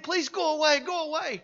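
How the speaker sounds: high-pitched mock begging; retold frightened plea. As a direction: plead like a cornered woman in a story. Terrified woman's plea, retold.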